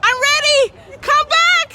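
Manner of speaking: high loud calls after the ship; hammed-up cry of don't leave me; mock pleading shout. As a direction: plead with the ship like a left-behind passenger. Begging the ship to come back.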